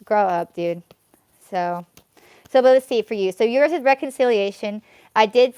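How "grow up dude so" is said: normal talking voice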